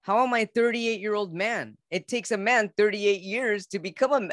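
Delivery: high, exasperated inner argument; incredulous protest. Retold or imitated. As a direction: protest in disbelief, pitched well up. Retelling an anguished inner protest.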